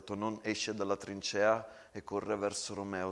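ordinary delivery, nothing marked

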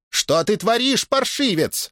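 high-pitched scolding aunt; indignant exclamation. A narrator's reading. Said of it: "воскликнула Теодора". (возмущённый возглас тётки)

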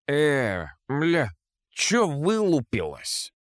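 put-on slurred, belligerent drunk; drunk slurring. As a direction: slur it like a picking drunk. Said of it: "продолжил он заплетающимся языком".

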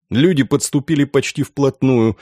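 plain reading voice

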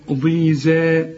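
ضي ذا slow, with a light ذا; correct zay. Shown as a demonstration. Teacher correcting the student, slow light zay. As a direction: say ضي ذا slowly, not ضي ظا. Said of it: "ما تقولش ضي ظا".

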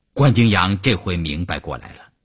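usual speaking voice

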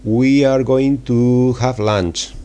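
slow, deliberate Indian English accent. Shown as a demonstration. Teacher demoing Indian English for Spanish learners, slow Indian accent. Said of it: "Cuando, cuando tienes que decir".

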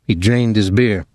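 ordinary delivery, nothing marked

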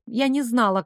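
plain reading voice

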